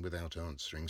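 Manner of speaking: ordinary delivery, nothing marked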